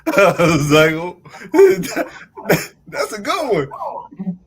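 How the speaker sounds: high, loud words through laughter; laughing. Real, not acted. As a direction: laugh while talking. Laughing at the caller.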